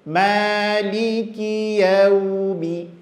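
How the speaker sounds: "Maliki yaumi" chanted slowly with thin smiling a; ma tipis, senyum. Shown as a demonstration. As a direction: smile through a long thin "Maliki". ("Maliki yaumi" pelan, a tipis sambil senyum)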